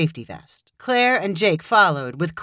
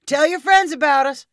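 called out, higher and brighter; calling out; 8 semitones higher than usual. A narrator's reading. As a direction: call it after him. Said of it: "Jake called".

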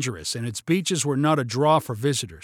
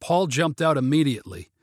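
ordinary delivery

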